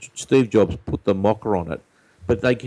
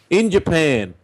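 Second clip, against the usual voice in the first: put-on old radio voice; 6 semitones higher, slower than usual. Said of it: "the old radio voice".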